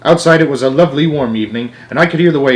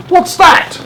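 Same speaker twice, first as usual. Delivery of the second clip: startled driver; 5 dB louder than usual. Acted.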